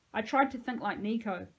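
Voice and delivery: plain reading voice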